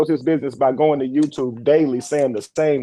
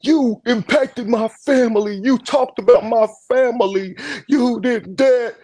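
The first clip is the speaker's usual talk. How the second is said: mocking whiny accuser; 7 semitones higher, slower than usual.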